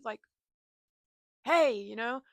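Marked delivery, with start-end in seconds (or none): 1.4-1.7 mock shout. Mock confronting someone.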